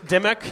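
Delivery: Dimmock with a short i, rising; tentative name attempt. Host introducing the guest, "Dimmock" said as a tentative DIM-uck.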